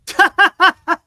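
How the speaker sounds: loud, high laugh; real laugh bursting out loudly; burst of laughter. Real, not acted. Host reacting to a weak batting average, loud laughter.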